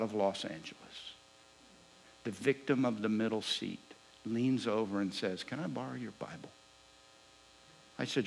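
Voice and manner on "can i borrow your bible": quiet request. Retold or imitated. Seatmate leans over, asks quietly.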